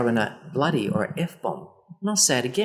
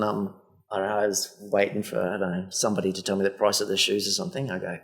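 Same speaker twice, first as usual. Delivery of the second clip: ordinary talk, nothing marked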